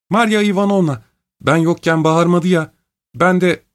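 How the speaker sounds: put-on high young woman's voice calling ahead; young daughter's voice. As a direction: pitch it up high as she hurries in calling. Liza calls coming upstairs.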